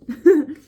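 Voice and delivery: loud, high burst of laughter; sudden high giggle; short laugh. Real, not acted. Laughs at own put-down.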